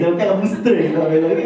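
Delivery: ordinary delivery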